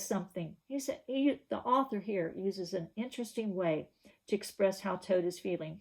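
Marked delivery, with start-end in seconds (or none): none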